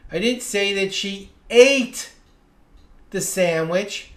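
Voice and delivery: "ate" punched loud, pause after it; stress on ATE. Shown as a demonstration. Teacher showing she did something else with it, stress on "ate". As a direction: build up to a hard "ate". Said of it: "I changed the pitch of my voice".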